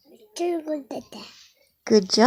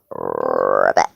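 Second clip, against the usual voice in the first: very low croaked ribbit; frog ribbit; 4 dB quieter than usual. Pretend. Parent showing a child the frog's noise, croaking.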